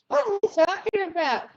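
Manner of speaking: very high, quick puzzled voice; mock bewilderment. Host imitating everybody lost in the chat, mock bewildered. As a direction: put on a high, confused onlooker's voice. Voicing the baffled chat audience.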